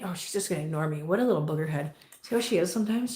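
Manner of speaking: normal talking voice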